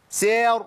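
سير high and loud with a long ي; سير pushed out with effort; effortful strained vowel. Shown as a demonstration. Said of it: "حسيت إن نفسي هيروح". (سير بمجهود ومد زائد)

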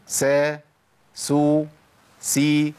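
"سا، سو، سي" each sounded separately; seen vowel drill. Shown as a demonstration. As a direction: recite "سا، سو، سي" evenly as a drill. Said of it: "هجيب الحالات ورا بعضها".

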